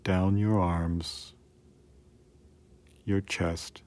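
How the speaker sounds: ordinary talk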